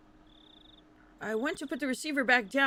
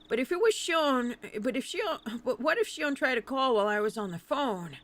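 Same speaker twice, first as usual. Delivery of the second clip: ordinary talk, nothing marked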